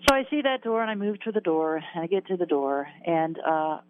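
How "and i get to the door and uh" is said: ordinary talk, nothing marked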